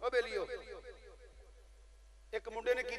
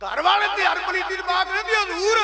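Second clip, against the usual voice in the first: loud, high-pitched full-force preaching shout; real sermon shout at full volume; impassioned preaching; 9 semitones higher, 14 dB louder than usual. Real, not acted. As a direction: thunder the warning to the congregation.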